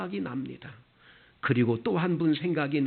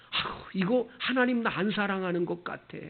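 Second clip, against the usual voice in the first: whiny doubter; 7 semitones higher than usual. Retold or imitated. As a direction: whine like someone sure God has left them. Mimicking a doubting believer. (하나님을 의심하는 신자를 흉내 내며 투정하듯)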